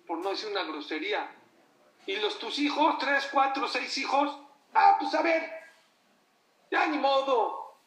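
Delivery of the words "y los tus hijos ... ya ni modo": much higher and louder exasperation; loud, high indignant rebuke; indignant outburst. Real, not acted. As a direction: throw it at them in disbelief. Preacher indignant at abandoning parents.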